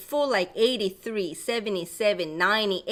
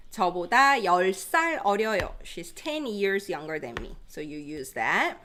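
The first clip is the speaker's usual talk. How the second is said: usual speaking voice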